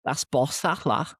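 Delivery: high-pitched Liverpudlian Scouse; Scouse accent. Acted. Host shows how Liverpudlians praise things, Scouse accent. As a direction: imitate an approving Scouser. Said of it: "if a Liverpudlian wants to say".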